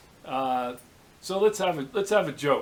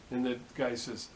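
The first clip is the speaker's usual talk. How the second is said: normal talking voice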